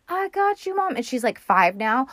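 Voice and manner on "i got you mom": put-on much higher little-kid voice; high mock child voice, calmly sure of itself; creepy child. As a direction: play the eerie five-year-old. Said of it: "Americus is just like".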